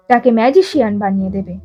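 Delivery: ordinary delivery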